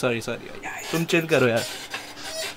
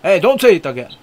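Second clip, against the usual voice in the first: louder, higher telling-off; raised-voice mock reprimand; mock scolding; 4 semitones higher, 6 dB louder, faster than usual. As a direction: warn sharply, as if fed up. Scolding a stranger who swears.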